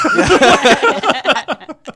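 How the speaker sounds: high, loud laugh; loud burst of laughter. Real, not acted.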